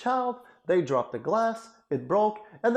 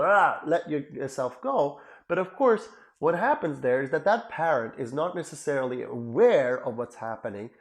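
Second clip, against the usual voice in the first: normal talking voice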